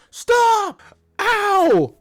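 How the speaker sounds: very high, loud shriek of "Stop! Ow!"; mock yelp. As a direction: squeal it like you just got clobbered. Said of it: "then she just hit it with the".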